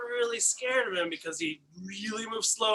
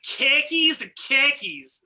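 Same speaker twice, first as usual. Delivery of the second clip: Boston accent; 6 semitones higher, 5 dB louder, much slower than usual. Shown as a demonstration. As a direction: lay the Boston accent on thick. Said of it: "go for your most stereotypical Boston accent".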